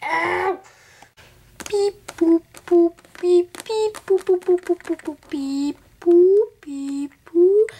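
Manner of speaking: high, loud robotic beep boop; robot alien beeps. Pretend. Doing her robot alien dance, high loud robot beeping. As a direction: chant beeps like a robot on the march.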